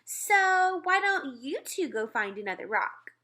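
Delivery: smug. Acted. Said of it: "as if she owned the park".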